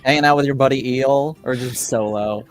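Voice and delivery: ordinary delivery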